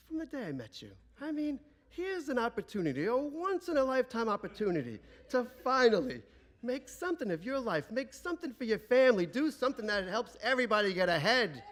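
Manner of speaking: put-on high-pitched, indignant old-lady tirade; scolding grandmother. Grandmother laying into her son-in-law, scornful.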